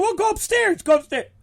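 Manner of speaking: high-pitched flustered urging; urgent shooing. Retold or imitated. Promoter sending the panicking wrestler upstairs, urgent shooing. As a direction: pipe it up high, hurrying her off. Urging her away from him.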